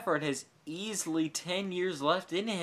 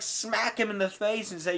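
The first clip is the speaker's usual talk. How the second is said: normal talking voice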